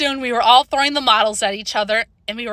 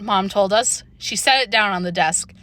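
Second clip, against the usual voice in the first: ordinary delivery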